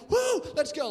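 high, loud pumped-up cry; hyped cheer. Retold or imitated. Student hyping himself up, high loud cheer. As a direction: fire up the room like a hype man.

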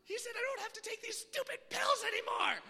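put-on high, loud, whiny teen shouting to mom; mock kid yelling. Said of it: "gonna go home and be like".

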